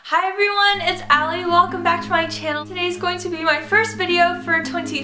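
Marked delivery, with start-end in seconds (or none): none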